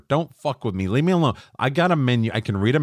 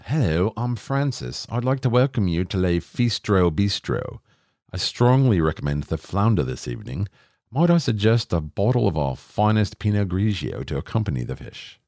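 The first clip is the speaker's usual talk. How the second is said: hammed-up formal host with swooping phrases; put-on fancy restaurant greeter; pretentious maitre d'. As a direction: recommend it with mock fine-dining grandeur. Mimics pretentious maitre d' greeting diners.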